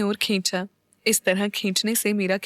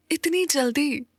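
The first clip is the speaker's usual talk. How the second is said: surprised; 5 semitones higher, slower than usual. A narrator's reading. Bride reacts to wedding being near.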